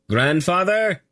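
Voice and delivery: high calling shout up to the windows. A narrator's reading. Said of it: "he shouted at the windows above".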